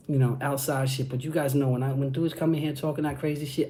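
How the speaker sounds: ordinary talk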